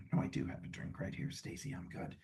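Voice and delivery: a quick hushed word to Stacy nearby; whispered aside. Real, not acted. Speaker whispering an aside to Stacy.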